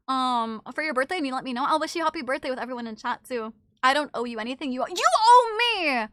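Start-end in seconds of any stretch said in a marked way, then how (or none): 4.7-6.1 higher, louder, slow whiny accusation; mock demand. Streamer playing chat demanding tendies, whiny. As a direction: drag it out like a spoiled kid.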